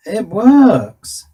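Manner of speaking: loud, high exclamation of success; real burst of triumph; triumphant. Real, not acted. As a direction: sing out your delight that it's working. Test of circuit succeeds.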